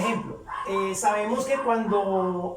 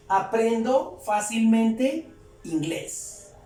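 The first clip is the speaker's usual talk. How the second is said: slow, drawn-out words with rising ends; slow translation; slower than usual. Shown as a demonstration. Teacher translating for learners, slowly word by word. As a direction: stretch each word for learners.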